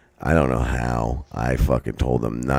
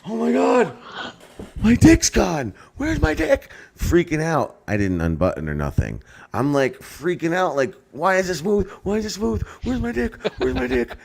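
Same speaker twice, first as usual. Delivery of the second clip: high frantic panicked squeal; hammed-up panic, voice shooting way up; mock panic. Panicked retold self at urinal.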